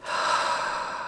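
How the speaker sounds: long audible sigh out; centering out-breath; demonstrated exhale. Shown as a demonstration. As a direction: let the breath go in a soft sigh. Models breathing during meditation.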